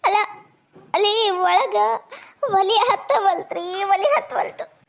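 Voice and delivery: drawn-out wail of complaint; tearful confession. Acted. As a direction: wail it out helplessly. Wife blurts excuse in tears. (ಗೋಳಾಡುತ್ತಾ ಅಳುದನಿಯಲ್ಲಿ)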